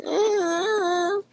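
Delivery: high squeaky puppet hum; squeaky ventriloquist dummy. Acted. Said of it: "It's like".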